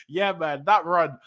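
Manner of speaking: usual speaking voice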